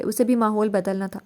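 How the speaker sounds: usual speaking voice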